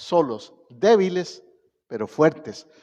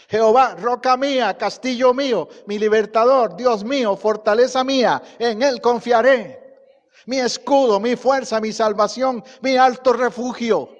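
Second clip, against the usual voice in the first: louder, higher psalm proclaimed with conviction; bold declaration; 6 semitones higher, 7 dB louder than usual. Shown as a demonstration. Preacher showing how David speaks, proclaiming it louder. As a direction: shout out each name of God like a battle cry. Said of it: "¿Así habla usted?".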